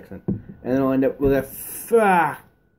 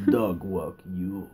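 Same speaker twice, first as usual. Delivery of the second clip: put-on Russian accent; 7 semitones lower, 8 dB quieter, much slower than usual. Soviet Russia joke.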